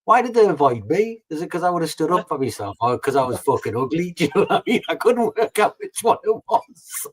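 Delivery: laughing self-mockery. Real, not acted. Joking about being too ugly.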